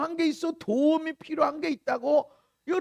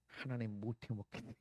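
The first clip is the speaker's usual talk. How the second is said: low, much softer grumble; weary complaint to God; 17 dB quieter than usual. Acted. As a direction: admit quietly that you give up. Acting out admitting exhaustion to God. (낮고 작게 내뱉는 푸념)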